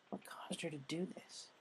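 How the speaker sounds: hushed troubled whisper. A narrator's reading. Rainbow wondering about Pinkie, in a hushed whisper. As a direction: breathe the question as if afraid.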